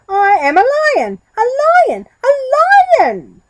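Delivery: very loud, very high desperate yell; shouting. Acted. Goat yelling at the giraffe in desperation, very loud. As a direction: scream that you are a lion.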